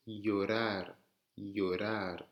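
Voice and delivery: slow LLORAR, said "yorar"; double L en Y. Shown as a demonstration. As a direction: stretch llorar, opening on a Y. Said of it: "Comme si vous aviez un Y". (llorar lentement, avec un Y)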